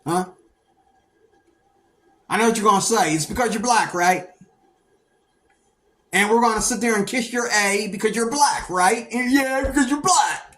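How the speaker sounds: imitating a teacher taunting a student; mocking teacher. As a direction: taunt him like a scornful teacher. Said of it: "teachers that would say stuff like".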